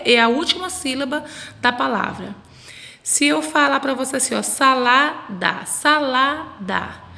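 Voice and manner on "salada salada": salada very slowly, middle la held long; syllable-by-syllable stress. Shown as a demonstration. Teacher quizzes children on salada, drawn out with stress on la. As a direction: stretch salada into three syllables, leaning on la. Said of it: "qual que é a sílaba mais forte".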